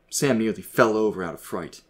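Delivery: ordinary delivery, nothing marked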